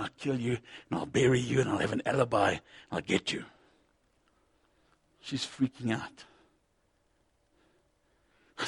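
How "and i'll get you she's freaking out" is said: usual speaking voice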